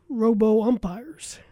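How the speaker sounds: ordinary delivery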